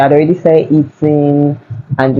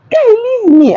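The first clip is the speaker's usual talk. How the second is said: sharp, much higher cry to be left alone; annoyed brush-off. Retold or imitated. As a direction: snap at the pestering guy, pitched way up. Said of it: "I didn't say that, but, like, I insinuated that".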